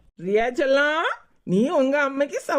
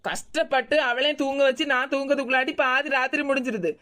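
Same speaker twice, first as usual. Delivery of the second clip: ordinary talk, nothing marked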